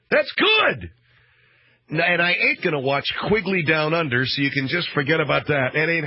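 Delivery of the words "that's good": much higher and louder, almost a yelp; delighted outburst. Real, not acted. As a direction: shout it out with sudden glee. Bursts out delighted.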